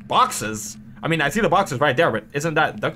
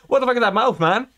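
ordinary talk, nothing marked

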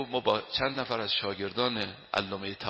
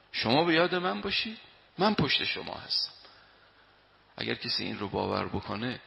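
normal talking voice

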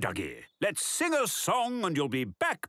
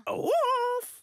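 startled cry. Acted. Narrator startled.

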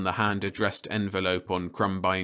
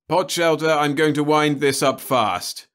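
higher, louder, decisive; brisk official; 8 semitones higher, 6 dB louder than usual. Acted. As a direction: take charge and end it. Crumbine wraps up briskly.

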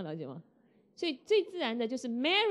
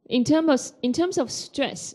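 louder emphasis; 5 semitones higher, 9 dB louder than usual. Shown as a demonstration. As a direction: hit the phrase hard to show stress. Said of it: "需要用力的".

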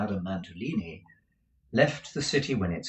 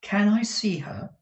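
put-on timid young woman; 12 semitones higher, 4 dB louder than usual. Kate's timid request.

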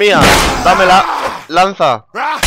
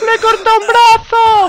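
shrieking; 13 semitones higher, 3 dB louder than usual. Real, not acted. Shrieking at a lost arm.

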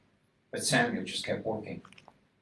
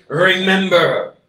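much louder, slow, higher command; divine command; 9 semitones higher, 14 dB louder, slower than usual. Acted. God commanding his people, booming and slow. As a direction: proclaim it as God's word.

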